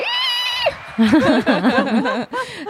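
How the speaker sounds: high shrieking laugh-scream. Pretend. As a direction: let out a big gleeful scream. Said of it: "我要一个尖叫声".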